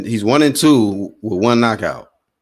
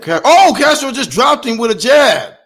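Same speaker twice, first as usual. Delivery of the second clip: excited; 7 dB louder than usual. Real, not acted. Knockdown in fight.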